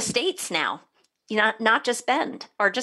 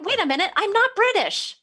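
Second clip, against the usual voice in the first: high-pitched mock self-correction; catching oneself; 11 semitones higher than usual. Host stopping her own British thoughts, in mock alarm. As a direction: pull yourself up short, pitched high. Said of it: "And I'm like".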